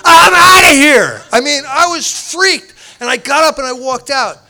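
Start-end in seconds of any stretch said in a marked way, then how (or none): 0.0-1.2 loud, high, drawn-out exclamation; retold outburst. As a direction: blurt it big, stretching every word. Tells of freaking out and leaving.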